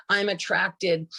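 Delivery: normal talking voice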